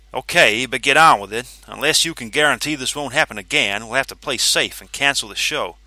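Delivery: loud, grudging firm order; grudging authority. Acted. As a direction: give in but keep control. Vanzetti grudging, after sigh.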